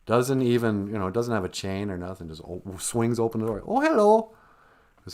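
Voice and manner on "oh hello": high, bright little hello, louder; sweet old grandma. Retold or imitated. Grandma opening the door to the wolf, high sweet voice. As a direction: greet the visitor in a high old-lady voice.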